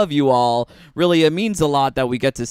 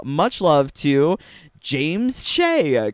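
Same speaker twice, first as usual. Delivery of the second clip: ordinary talk, nothing marked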